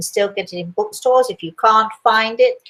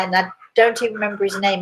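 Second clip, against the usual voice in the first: usual speaking voice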